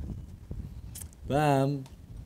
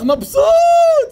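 much higher, louder, stretched cry; joyful shout; 8 dB louder, much slower than usual. Real, not acted. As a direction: stretch out the joy.

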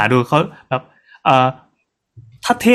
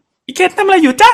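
very high, squeaky joke voice; mock talking bird; 4 dB louder than usual. Joking that the bird suddenly speaks, high squeaky bird voice.

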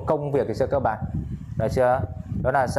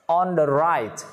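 "On the right" drawn out, higher pitched; slow model phrase; 4 semitones higher, much slower than usual. Shown as a demonstration. Teacher modelling the phrase for students, slow and deliberate. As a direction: stretch each word of "On the right" for learners.

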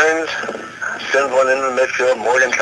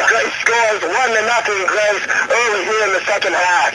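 excited; 9 semitones higher than usual. Real, not acted. Joyful goal recap.

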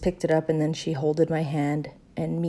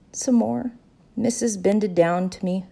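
ordinary delivery

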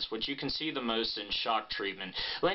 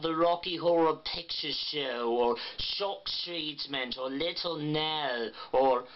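slow, exaggerated vowels with a tight jaw; Pat Quinn impression; slower than usual. Shown as a demonstration. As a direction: drawl her topics like Pat Quinn. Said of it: "practicing with topics that she generally talks about".

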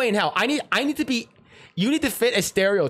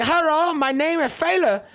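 playful mock Chinese accent, pitched up; 8 semitones higher than usual. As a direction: put on an exaggerated Chinese accent to pitch himself for the role. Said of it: "and I talk like this".

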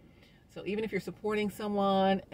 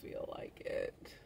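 soft unvoiced groggy whisper; sleepy whisper; 3 semitones lower, 10 dB quieter than usual. Sleepy woman whispering she doesn't want to get up. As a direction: breathe it out, too sleepy to care. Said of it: "I'm like".

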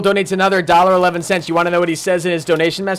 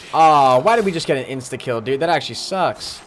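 normal talking voice

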